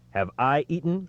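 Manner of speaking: lightly cried protest; mild cry. Retold or imitated. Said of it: "cried Christophe".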